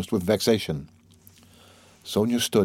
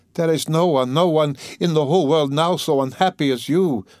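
frenzied cry; 6 semitones higher than usual. A narrator's reading. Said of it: "she cried in a frenzy".

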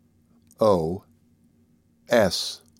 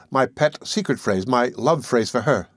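ordinary delivery, nothing marked

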